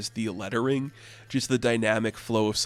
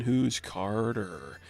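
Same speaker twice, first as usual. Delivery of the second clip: very slow, dying words; slow, faded last question; fading villain; 4 dB quieter, much slower than usual. Acted. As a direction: let it trail off as he crumbles. Villain dying, turning to dust.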